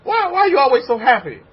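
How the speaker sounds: questioning onlooker. Retold or imitated. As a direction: play the amazed neighbour asking why you're happy. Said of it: "they ask you this question".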